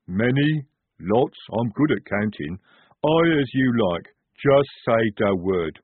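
slow, dim troll voice, proud of counting; a big simple troll, slow and cheerful; slow troll private eager to help the lieutenant. Acted. Troll Jade's voice.

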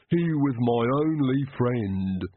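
slow, lisping servant voice in grief; grieving Igor. Acted. Igor over his dead dog, slow grieving servant voice. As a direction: mourn the dog slowly, thick-voiced.

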